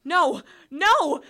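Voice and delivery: loud desperate scream. Acted. Fleeing boy screaming at the monster, loud desperate scream. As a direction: scream it with everything. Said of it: "I screamed".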